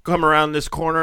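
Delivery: ordinary delivery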